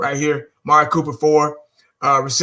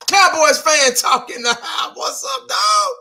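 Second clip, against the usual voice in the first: excited shout; 11 semitones higher, slower than usual. Real, not acted. Hyped greeting to a viewer.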